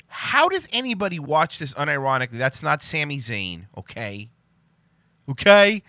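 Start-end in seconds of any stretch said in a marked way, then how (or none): 5.3-5.8 loud exasperated. Real, not acted.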